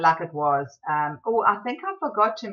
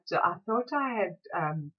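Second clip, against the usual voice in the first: usual speaking voice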